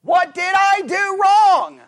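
high, loud, drawn-out cry; exasperated protest. Acted. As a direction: demand it slowly, high and loud. Voicing someone's hurt protest.

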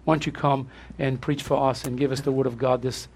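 usual speaking voice

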